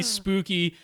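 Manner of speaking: normal talking voice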